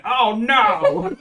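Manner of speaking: loud, high wail of mock alarm; mock dismay. Teasing partner about their reaction to self-worth, mock dismay. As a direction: imitate a panicked cry, laughing through it. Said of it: "you sounded genuinely like".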